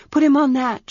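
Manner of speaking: put-on a young priestess pressing for an answer; Arha's voice. Young priestess Arha urgently asks her servant for a room.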